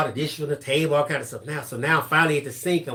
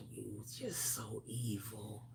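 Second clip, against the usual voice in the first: hissed, breathy whisper; whispered accusation; 7 semitones lower, 23 dB quieter, slower than usual. Retold or imitated. Relatives whispering about the evil son. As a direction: whisper it with disgust.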